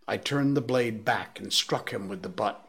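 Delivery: usual speaking voice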